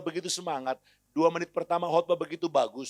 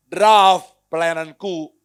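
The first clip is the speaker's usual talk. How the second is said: usual speaking voice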